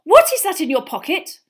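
loud, high accusing question; angry mum catching someone out; stern interrogation. Acted. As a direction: demand an answer like a cross mother. Mother interrogating her guilty son.

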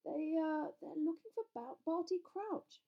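high-pitched, faltering reply; nervous. A narrator's reading. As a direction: stumble uneasily over the name. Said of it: "looking suddenly nervous".

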